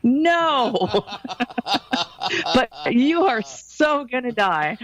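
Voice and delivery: high, laughing through the words. Pretend. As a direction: crack up while saying it. Joking what she should have said.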